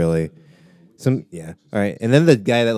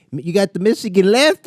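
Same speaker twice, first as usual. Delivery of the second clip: higher, slightly put-on Boston accent; mock Boston accent; 10 semitones higher, 3 dB louder than usual. Comic attempting a Boston accent, higher pitched. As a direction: try a clumsy Boston accent. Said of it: "how you do the accent".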